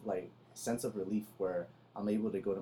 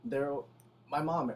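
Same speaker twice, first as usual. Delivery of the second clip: ordinary talk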